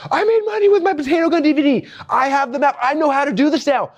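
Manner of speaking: high, thrilled self-quote; excited. Retold or imitated. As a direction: burst out like you just won. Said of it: "I'm like".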